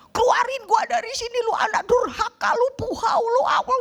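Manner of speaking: high, shrill-free angry scolding of her son; angry mother yelling. Retold or imitated. Mother scolding her son, high angry yell. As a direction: scold the child as the panicked mother. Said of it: "Dia marahin anaknya".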